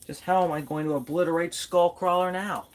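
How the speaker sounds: ordinary delivery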